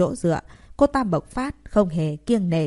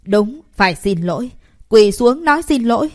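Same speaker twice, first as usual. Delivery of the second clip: a louder, higher angry shout; the crowd yells at her; 4 semitones higher, 6 dB louder than usual. A narrator's reading. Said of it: "hét lớn". (học sinh hét lớn, căm phẫn)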